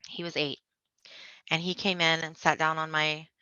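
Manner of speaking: ordinary talk, nothing marked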